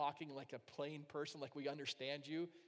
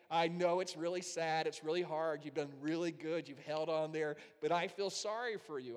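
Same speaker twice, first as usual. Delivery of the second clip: mock sympathy; 9 dB louder than usual. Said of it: "I wanted God to say".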